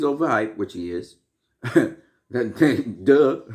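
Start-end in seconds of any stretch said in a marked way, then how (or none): 1.6-3.4 laughing through a scornful duh; mocking laugh. Real, not acted. Mocking someone whose brain switched off, laughing. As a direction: break into a laugh on a loud duh.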